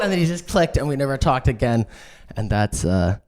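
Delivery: ordinary delivery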